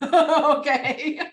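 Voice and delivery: usual speaking voice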